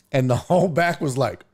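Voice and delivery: ordinary delivery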